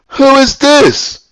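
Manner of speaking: high, drawn-out mock-incredulous demand; indignant disbelief. Student answering a stranger who grabbed the phone, high indignant disbelief. Retold startled comeback on phone.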